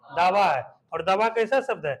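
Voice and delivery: ordinary delivery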